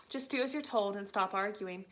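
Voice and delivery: ordinary delivery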